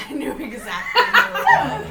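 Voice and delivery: high, loud laughing teacher quote; laughing while quoting the teacher. Retold or imitated. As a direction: burst out laughing through the line.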